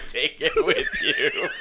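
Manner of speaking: slow words squeezed through laughter; laughing. Real, not acted. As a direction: crack up while saying it. Laughing at the story.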